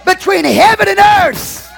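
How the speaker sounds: slow proclamation building to a high shout; preaching. Acted. Pastor linking the angel to the cross, fervent shouted preaching. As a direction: build relentlessly to a shouted peak, then ease off.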